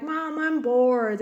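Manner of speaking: slow, drawn-out whine; bored child. Retold or imitated. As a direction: drag it out like a bored kid. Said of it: "they start being like".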